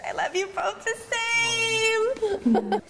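high, drawn-out fake-tearful wail; mock crying. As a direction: plead in a slow, hammed-up sob. Mock-tearful refusal to pick one.

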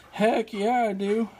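high, eager retold yes. Retelling his eager reply to the landlord's food offer, high and thrilled. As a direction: pitch it way up like a thrilled kid offered dinner. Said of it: "And I was like".

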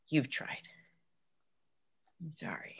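soft whispered apology. Acted. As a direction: whisper it as you end things. Whispered breakup.

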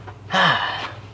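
short breathy sigh of relief. Real, not acted. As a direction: let out a quick sigh as the mask settles. Adjusting mask, sighs.

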